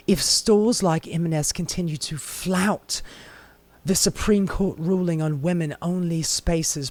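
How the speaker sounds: mock English accent, lightly done; a hint of a prim English accent. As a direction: read the quote in a put-on English accent. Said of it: "let me give her an English accent".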